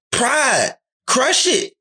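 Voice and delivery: usual speaking voice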